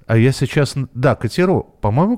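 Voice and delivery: ordinary talk, nothing marked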